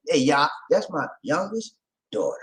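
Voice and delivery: ordinary talk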